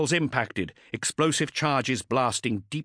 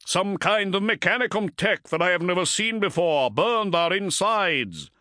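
grim commander, high and forceful; grim battle report in a raised voice; 9 semitones higher, 3 dB louder than usual. A narrator's reading. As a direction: report grimly, drawing out the last words. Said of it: "said Motep grimly".

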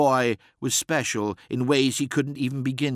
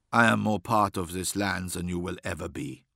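low stern warrior; 5 semitones lower, 3 dB quieter than usual. Acted. Azazel's steady vow.

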